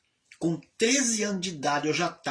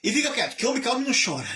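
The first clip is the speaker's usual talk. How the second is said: father comforting gruffly; 7 semitones higher, much faster than usual. Retold or imitated. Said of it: "e dizia".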